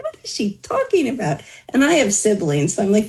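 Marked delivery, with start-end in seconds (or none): 0.0-1.4 higher-pitched, retold disbelief; puzzled aside, pitched up; baffled. Daughter reacting to mom's summons, baffled aside.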